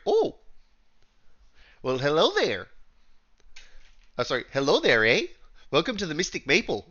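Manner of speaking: high, chirpy Canadian shopkeeper with "eh"; a cheery Canadian shop owner greeting customers. Acted. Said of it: "I figured in every fucking Canadian stereotype I could into this lady".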